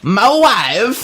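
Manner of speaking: high, drawn-out mock impression; Sean Connery impression. Co-host showing off another impression, hammed-up high catchphrase. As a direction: call it out high in a silly put-on voice. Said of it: "really good Sean Connery impression".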